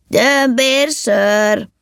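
high, very drawn-out call; blaring shout. A narrator's reading. Elf shouting drink ideas from the cart, long high holler. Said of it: "és egyre harsogott".

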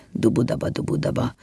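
soft rhythmic dubudaba; quiet galloping patter, fading away; imitated hoofbeats. A narrator's reading. Horses trotting with the cart, soft imitated hoofbeats.

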